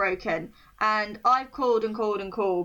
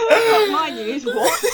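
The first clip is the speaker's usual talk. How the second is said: loud, high burst of laughing protest; laughing disbelief; 4 semitones higher, 7 dB louder, slower than usual. Real, not acted. Host reacting to her friend's haggis news, laughing in disbelief. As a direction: protest through real laughter, pitched up.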